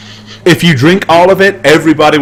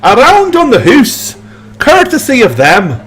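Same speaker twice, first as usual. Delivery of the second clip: high, slow Scottish toast; a bar-wide Scottish cheer; Scottish accent; 10 semitones higher than usual. Acted. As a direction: boom it out like a generous clansman. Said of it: "He says".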